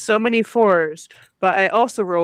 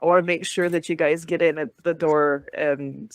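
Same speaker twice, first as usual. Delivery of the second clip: normal talking voice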